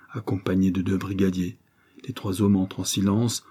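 ordinary delivery

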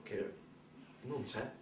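normal talking voice

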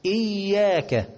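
high, slow "إياك" leaning on the ya; doubled ya. Shown as a demonstration. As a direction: hold the shadda in "إياك". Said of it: "من مواطن التشديد أهي". («إياك» بتشديد الياء)

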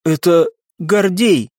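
slow, grieving statement; halting, a touch tearful. A narrator's reading. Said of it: "на её глазах показались слёзы".

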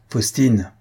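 ordinary delivery

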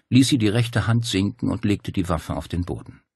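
plain reading voice

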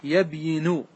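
full unmerged يبين, yab-yi-nu with final u; original underlying form. Shown as a demonstration. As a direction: say يبين as yab-YI-nu, sounded y after b. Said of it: "أصله يبين". (نطق «يبين» على أصلها: يَبْيِنُ)

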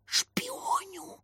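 high, hushed, drawn-out spooky whisper; ominous stage whisper; sinister whisper. A narrator's reading. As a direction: whisper it like a sinister little spy. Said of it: "зловеще прошептал Лёнька".

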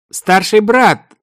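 loud high slow call. Acted. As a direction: call through the door. Calling through the door.

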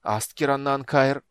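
loud slow faltering incantation; spell restarted. A narrator's reading. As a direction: start the spell again. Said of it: "запнулась и начала заново".

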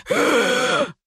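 sharp gasping breath in; a ragged gasp for air; loud gasp. A narrator's reading. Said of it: "Громкий вдох".

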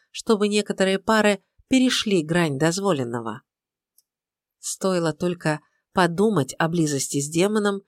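ordinary delivery

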